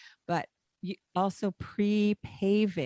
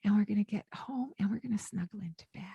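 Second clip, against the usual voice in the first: put-on fast, breathy whisper to a toddler; 4 dB quieter, much faster than usual. Shown as a demonstration. Mother whispering to her little one about snuggling into bed. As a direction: hush it like a secret promise. Soothing child toward bedtime.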